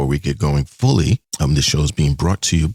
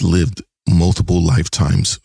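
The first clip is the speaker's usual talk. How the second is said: ordinary talk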